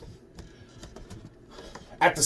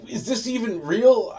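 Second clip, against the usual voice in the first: disbelieving; 9 semitones higher than usual. Retold or imitated. Feds asking absurd question.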